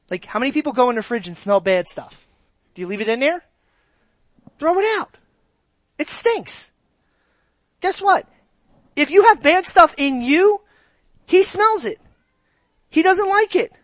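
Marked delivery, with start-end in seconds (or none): 4.6-13.7 much higher, louder insistence; excited high urging. Real, not acted. Preacher urging the church to throw out their sin, high and excited. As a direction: push it out with rising urgency.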